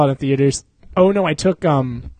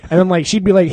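usual speaking voice

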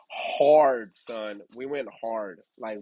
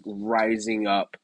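ordinary talk, nothing marked

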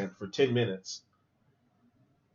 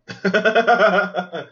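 loud, higher burst of laughter; real laugh; 6 semitones higher, 7 dB louder than usual. Real, not acted. Viewer cracking up at a pretty good joke, real laugh.

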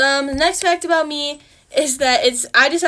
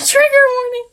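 high, fast, loud giggling repeat; real amused giggle; laughing echo; 12 semitones higher, 9 dB louder than usual. Real, not acted. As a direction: laugh the words out. Echoing trigger warning laughing.

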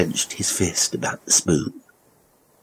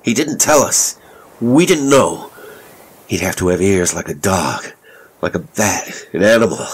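heated, incredulous outburst; angry shout; 2 semitones higher, 4 dB louder than usual. A narrator's reading. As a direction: protest loudly as an angry father. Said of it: "Elliot shouted angrily".

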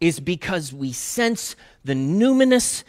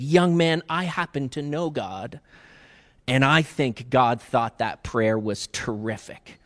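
put-on gentle old man; 5 semitones lower, 8 dB quieter than usual. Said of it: "leaned in close to the kid and kinda gave him a little wink".

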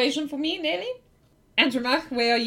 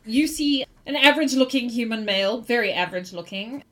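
ordinary talk, nothing marked

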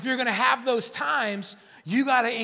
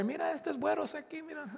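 mocking Mexican spectator; 6 semitones higher, 6 dB quieter, faster than usual. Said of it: "The Mexicanos are there".

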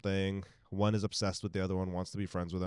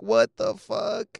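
slow, high, louder reaction; appalled disbelief; 11 semitones higher, 5 dB louder, much slower than usual. Retold or imitated. Recalling a gross film scene to co-host, slow appalled disbelief.

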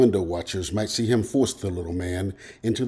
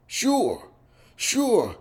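high, hearty agreement; 13 semitones higher than usual. A narrator's reading. Rick welcoming the little man, heartily. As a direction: agree eagerly, voice swooping down from high. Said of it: "Rick agreed heartily".

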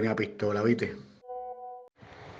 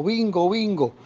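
mock shout; 7 semitones higher, slower than usual. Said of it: "y gritó".

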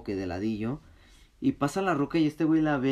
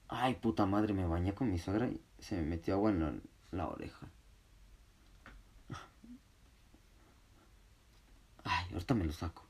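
quiet aside; 5 semitones lower, 8 dB quieter than usual. Real, not acted. Aside to someone in the room.

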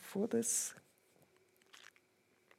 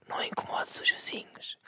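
imitating dad's whisper, much softer; mock whisper; 12 dB quieter than usual. Father whispering not to disturb the neighbours, mock whisper. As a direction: breathe it out voiceless. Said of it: "ele tenta falar baixo".

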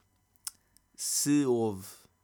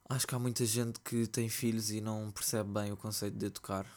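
ordinary talk, nothing marked

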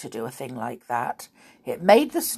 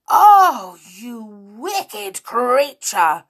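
high, loud, drawn-out outrage; enraged shout; 5 semitones higher, 10 dB louder, much slower than usual. A narrator's reading. Girl shouting at the wicked creature, enraged. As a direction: cry out in a rage. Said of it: "shouted Molly in a rage".

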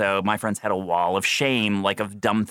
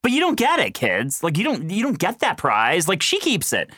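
exasperated explaining; 6 semitones higher than usual. Retold or imitated.

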